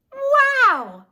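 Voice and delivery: very high, loud "Wow!"; amazed. A narrator's reading. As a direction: gasp it out in delight. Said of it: "said Leslie, tasting her piece".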